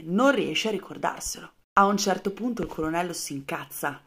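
ordinary talk

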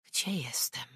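whispered to herself; waking woman whispering to herself. Acted. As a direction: breathe it out, voiceless and dazed. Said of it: "pytała siebie".

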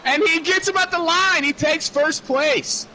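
high, loud, slowed race call; excited call. Real, not acted. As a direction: shout the pass for the win. Last-lap pass called live.

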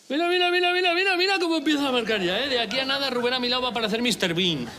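high-pitched, tumbling excitement; excited boasting. Acted. Husband shows his partner his new muscle, excited and high. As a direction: squeal it, then gradually come down.

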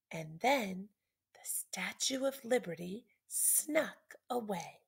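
breathy secret narration; hushed whisper. A narrator's reading. Narrator whispering as Liberty sneaks away at night.

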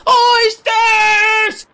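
loud, high cry; a boy tugging his father and crying for oysters. A narrator's reading. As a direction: yell it, pulling at his coat. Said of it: "I cried".

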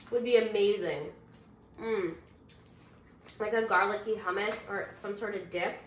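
talking with food in mouth; chewing while talking. Real, not acted. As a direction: mouth partly full. Seen 3: Bites snack before; mouth pursed and chewing between words, piece in hand.

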